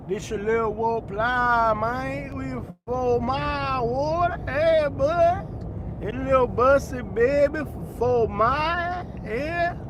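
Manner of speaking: drawn-out Fort Myers slur, phrases lifting at the end; Fort Myers accent. Shown as a demonstration. Streamer demonstrating Fort Myers talk, thick Fort Myers accent. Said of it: "I'm gonna do a Fort Myers accent".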